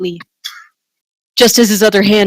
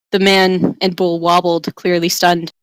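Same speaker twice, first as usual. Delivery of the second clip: normal talking voice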